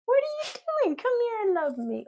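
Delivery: very high pet-talk voice; talking to the dog. Real, not acted.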